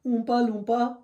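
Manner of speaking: high-pitched regional British catchphrase accent; imitated catchphrase accent. Shown as a demonstration. Showing an accent he can manage, high imitated accent. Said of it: "the only one I can do properly".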